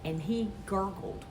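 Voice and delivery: usual speaking voice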